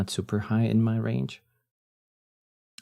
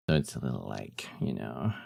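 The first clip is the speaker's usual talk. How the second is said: low, husky voice; deep morning voice; 10 semitones lower, 7 dB quieter than usual. Shown as a demonstration. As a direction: drop into a husky morning growl. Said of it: "That hot, deep morning voice".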